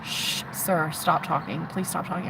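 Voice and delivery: hushed, almost whispered warning; urgent hush. Retold or imitated. Hushing the new guy in the group, urgent whisper. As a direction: shush him quietly before others hear.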